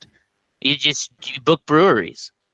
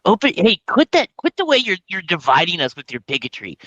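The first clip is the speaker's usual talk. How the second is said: mock outrage; 7 semitones higher than usual. Mock preaching friend's stance.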